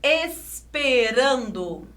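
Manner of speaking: "esperando" drawn out as ES-PE-RAN-DO; slow syllables. Shown as a demonstration. Teacher presenting the next word, slow syllables. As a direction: say "esperando" slowly, syllables stretched. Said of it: "Aqui temos a palavra".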